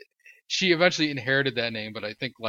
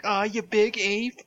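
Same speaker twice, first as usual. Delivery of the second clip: high, louder, drawn-out cartoon cry; cartoon voice impression; 10 semitones higher, 5 dB louder, slower than usual. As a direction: do the same hammy cartoon-hero shtick. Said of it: "doing the same shtick".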